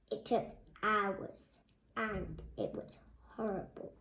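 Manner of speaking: soft dramatic dread; hushed gloom. Acted. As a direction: drop to a grim hush. Reading dramatically.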